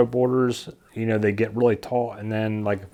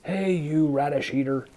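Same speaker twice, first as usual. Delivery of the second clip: lightly voiced teasing jab, a little higher; mock put-down; 4 semitones higher than usual. Gardener to viewers, a mock put-down in a light teasing voice. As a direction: toss it off like a playful schoolyard insult. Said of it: "almost be like a put down".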